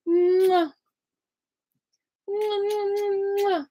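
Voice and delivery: high little smacking kisses. Real, not acted. Kissing her own hurt wrists, high smacking kisses.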